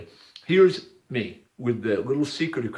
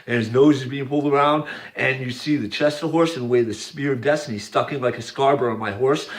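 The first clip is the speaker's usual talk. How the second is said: ordinary talk, nothing marked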